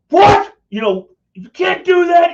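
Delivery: high, loud outraged yell; mock outraged shout. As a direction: shout it like you can't believe it. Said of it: "he wanted to jump up and go".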